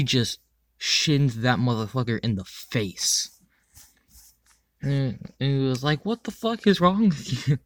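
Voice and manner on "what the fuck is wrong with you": high-pitched retold exasperation; exaggerated high-voiced disbelief; mock incredulous outburst. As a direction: act out baffled protest, pitched well up. Retold outburst at being attacked.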